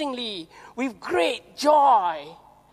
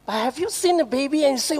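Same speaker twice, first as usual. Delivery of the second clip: ordinary talk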